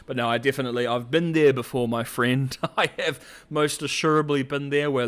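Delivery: ordinary talk, nothing marked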